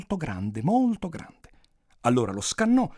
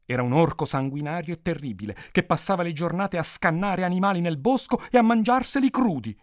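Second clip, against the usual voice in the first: usual speaking voice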